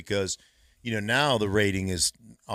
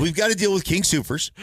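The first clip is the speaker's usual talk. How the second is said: higher, louder mock panic; 10 semitones higher, 7 dB louder than usual. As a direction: play a team scrambling for a sponsor.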